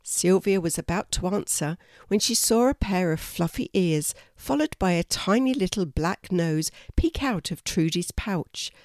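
ordinary delivery, nothing marked

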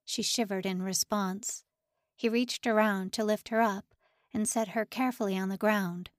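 ordinary delivery, nothing marked

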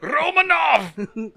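high, slow drawn-out call of the name; Scottish Russian accent. Acted. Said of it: "a bunch of Russians with Scottish accents".

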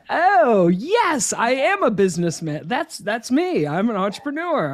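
high, puffed-up boast, then settling; mock pride. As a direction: proudly show off like a kid who just got it. Mock proud kid after learning word.

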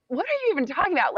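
fast, high, bewildered rush; retold disbelief. New girl stunned by the director's order, fast and bewildered. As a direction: blurt it out, completely lost. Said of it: "I was like".

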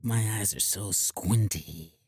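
low, soft, very slow drawn-out movie tough-guy voice; quiet low-voiced squinting gunslinger; Clint Eastwood. Patient playing squinty-eyed tough guy for a therapist, low slow impression. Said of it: "Clint Eastwood".

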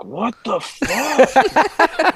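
realizing it's serious, drawn-out what the fuck; shocked. Retold or imitated. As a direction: stretch the disbelief out long and high. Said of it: "And now I'm like".

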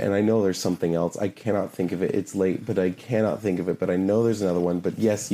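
ordinary talk, nothing marked

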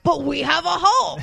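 lightly voiced smug quip; smug comeback. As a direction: deliver it like a cocky hero. Retold Tony's comeback.

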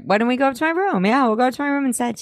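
high, rushed nervous impression; hurried, high-pitched mock nerves; nervous suggestion. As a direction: imitate a jittery invitation, fast and high. Redoes Seth's suggestion, higher.

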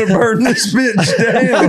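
high, slow, laughing repeat; laughing quote. Retold or imitated. Friend teasing Mitch with his bio line, laughing. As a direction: crack up while quoting it.

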